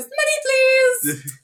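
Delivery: high sing-song "money", then dropping; mock a greedy plea in a high, sung voice; begging military.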